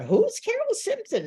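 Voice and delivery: high, nosy question; mocking curious crowd. Reporters at the press conference asking, high and puzzled. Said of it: "Everybody was going".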